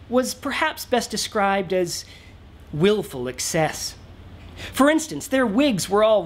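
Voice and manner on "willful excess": ordinary delivery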